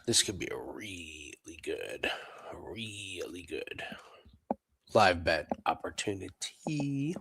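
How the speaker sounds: hushed whisper so as not to wake anyone. Real, not acted. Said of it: "I know this is strange that I'm whispering".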